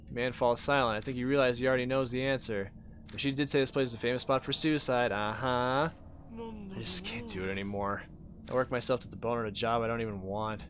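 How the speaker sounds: ordinary talk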